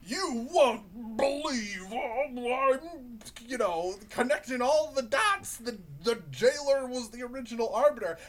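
high, loud, drawn-out clickbait voice; mock an overexcited video host announcing a big reveal; hyped YouTuber parody. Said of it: "YouTubers to make videos like".

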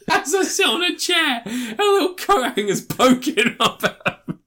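high, loud comic voice breaking with laughter; laughing performance. Pretend. Co-host riffing on a film plot, laughing high voice. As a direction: put it on high through laughter. Acts a line amid laughter.

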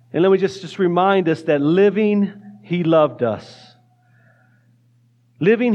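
low, earnest spoken prayer; hushed pastoral prayer voice. Acted. Leads congregation in prayer.

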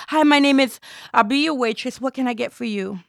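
waitress greeting. Acted.

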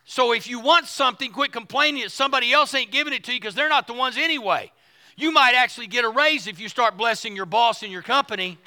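forceful, rhythmic pulpit delivery; full-voiced sermon with punched key words; preaching. Real, not acted. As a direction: drive each point home to the congregation.

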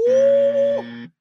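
sudden very high, loud woo; high yelp of delight. Real, not acted. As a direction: let out a squealing whoop. Reacting to the warm rag.

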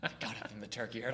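quiet, brooding Cage build-up; Nicolas Cage impression. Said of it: "Terrible Nicolas Cage impression".

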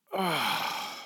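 long, soft, low sigh from the soul; deep sigh. Shown as a demonstration. Preacher demonstrating to the congregation, deep sigh. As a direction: let all the air out heavily. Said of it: "It's just a deep sigh from the soul".

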